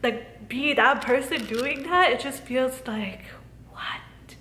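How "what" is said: disbelieving whisper. Real, not acted. Stunned disbelief at her own life.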